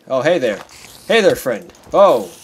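loud, jumpy hello to a creature; startled greeting. Real, not acted. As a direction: greet it while taken aback.

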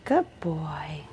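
soft whispered praise for the dog. Real, not acted. Groomer whispering praise to the dog in the tub, whisper. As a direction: breathe it gently to calm him.

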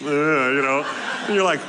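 ordinary talk, nothing marked

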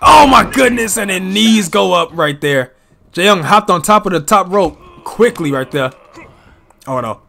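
excited live play-by-play calling; wrestling commentator. Acted. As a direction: call each move as it lands.

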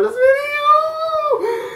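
high-pitched, drawn-out nervous squeal; nervous excitement rising into a squeal. Real, not acted. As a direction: squeal with nerves, stretching the words out. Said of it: "I'm so nervous".

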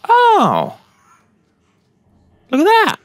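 high, loud squeal of surprise; startled exclamation. Real, not acted. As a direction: cry out in sudden surprise, pitch shooting up. Startled by sight in the game.

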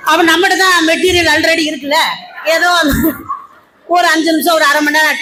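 ordinary talk, nothing marked

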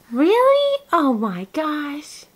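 very high, drawn-out amazement. Real, not acted. Mother answering her baby's coos, amazed baby talk.

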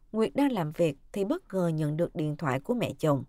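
plain reading voice

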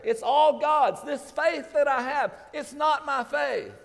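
booming revival preaching; preaching at full force. Acted. Preacher voicing God, full force.

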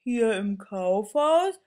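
a groggy teddy just woken by the phone; mildly sleepy bear voice. Acted. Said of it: "fragt der Bär verschlafen".

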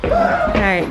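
high laugh into words; giggling. Real, not acted. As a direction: let the giggle spill over. Laughing after room claim.

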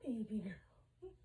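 soft slow whisper to the cat. Real, not acted. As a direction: breathe it gently, slow.

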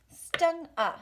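Stung a said as one connected beat; rhythm demo. Shown as a demonstration. Teacher demonstrating two sounds on one beat. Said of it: "Two sounds. One beat gets them connected".

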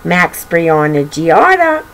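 ordinary delivery, nothing marked

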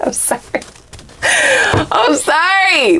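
real laughter breaking through a slow "I'm sorry"; giggling through a slow, drawn-out apology; laughing apology. Real, not acted. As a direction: try to apologize while still laughing. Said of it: "Let me stop laughing".

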